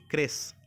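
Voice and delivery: "क्रेच" ending in s; correct crèche pronunciation. Shown as a demonstration. Teacher correcting the ch reading, "क्रेच" with a final s sound. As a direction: say "क्रेच" as "kres". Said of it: "ऐसे पढ़ेंगे क्रेच".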